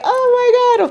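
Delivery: high-pitched mock wail; hammed-up tearful outburst; imitated boohooing. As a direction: wail it like a proud weepy mother. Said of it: "I was crying like a, like a boohoo".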